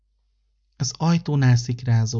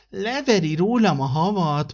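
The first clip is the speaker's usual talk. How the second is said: higher, plaintive little tree voice; complaining fir tree; 7 semitones higher than usual. Acted. The fir tree complains about the wren, higher and plaintive. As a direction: whine about the bird knocking off your snow. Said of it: "panaszkodott a fenyő".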